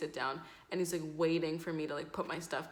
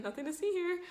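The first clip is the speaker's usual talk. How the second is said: high, feigned nonchalance; nothing-to-see-here act; 11 semitones higher, faster than usual. Retold or imitated. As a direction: pretend all is normal, pitched way up. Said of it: "that's how it kinda was".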